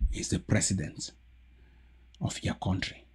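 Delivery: soft unvoiced scorn; scornful whisper. Real, not acted. Mocking the president to viewers, whispering. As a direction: whisper it with contempt. Bitter aside.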